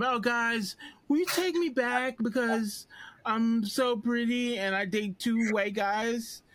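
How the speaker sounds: high, slow mocking voice of a spoiled teen; mock her, high and whiny; mocking whiny girl. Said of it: "my impression of Never Have I Ever".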